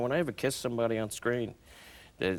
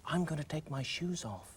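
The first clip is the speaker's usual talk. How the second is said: soft, higher confiding murmur; hushed retold aside in her voice; imitated whisper; 4 semitones higher, 7 dB quieter than usual. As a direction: confide it softly, as she did. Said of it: "and she whispers to me".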